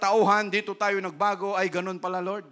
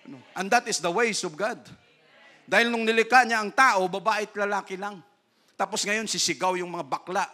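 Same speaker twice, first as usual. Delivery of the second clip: usual speaking voice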